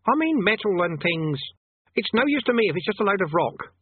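put-on high-pitched, complaining boy voice; impatient boy. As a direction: grumble high like a dissatisfied kid.

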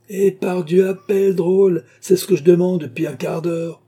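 high, loud, defiant retort; acted exasperated bellow; angry shouting back. A narrator's reading. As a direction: yell back impatiently through the door. Prince shouts back at the guard.

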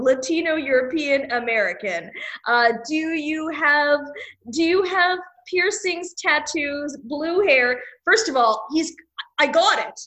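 ordinary delivery

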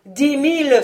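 loud, high bidding cry; exclaiming. A narrator's reading. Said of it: "s'écria Vaunois". (s'écrier, voix forte et aiguë)

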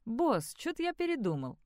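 muffled, as if through a helmet; muffled voice inside helmet. Acted. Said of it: "сказал глухой голос из-под шлема".

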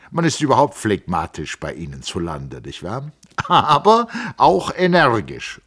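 a hint of the smiling Italian scholar; brisk, polite teasing; lightly voiced Settembrini. A narrator's reading. Settembrini turning the talk back to the engineer, lightly voiced.

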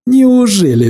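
loud, high mock surprise; sneering disbelief; mocking exclamation. A narrator's reading. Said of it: "всплеснул он сильными руками и зло захохотал".

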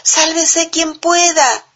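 high, slow panicked cry; panicked shout. A narrator's reading. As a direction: yell it while running away. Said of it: "gritaban todos".

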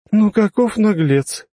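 high, slow exaggerated indignation; theatrical mock outrage. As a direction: play at being shocked. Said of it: "картинно прижала ладонь к щеке".

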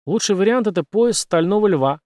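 usual speaking voice

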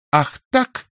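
high, sharp "Ах так!"; exclamation. Acted. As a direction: catch her out with a high exclaim. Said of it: "воскликнула она".

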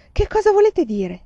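high, loud burst of alarm; alarmed question. A narrator's reading. Man told he dies tonight, alarmed. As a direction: demand the meaning in shock. Alarmed at prediction of his death.

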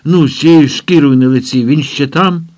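high, agitated questioning; nervous. A narrator's reading. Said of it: "Тимур нервувався і говорив незв'язно".

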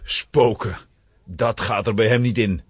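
ordinary delivery

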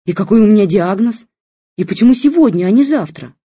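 loud high indignant demands; shouting. A narrator's reading. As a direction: yell at her, outraged. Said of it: "Тётя Женя продолжала кричать".

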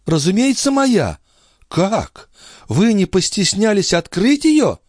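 high-pitched feminine outrage; indignant countess. A narrator's reading. Countess scolding the police chief over her casket, high and indignant.

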